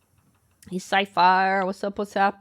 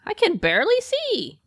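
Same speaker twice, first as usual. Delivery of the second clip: much higher, a squeaky little complaint; squeaky mock voice; 13 semitones higher, 6 dB louder than usual. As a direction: pipe it up high like a cartoon victim of the smog. Squinting at smoggy newspaper headline.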